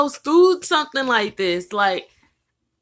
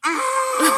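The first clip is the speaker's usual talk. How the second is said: sudden high laugh; burst of laughter; 11 semitones higher than usual. Real, not acted. Mom cracking up as her son crashes the video, laughing.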